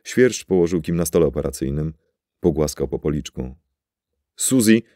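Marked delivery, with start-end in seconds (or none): 4.4-4.8 higher, louder call to the computer. A narrator's reading. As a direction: call the name across the lab. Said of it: "zawołał".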